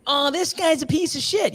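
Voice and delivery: high, put-on voice of fellow breeders; mock gossiping breeders. Breeders gossip about a bad seller, in a high put-on voice.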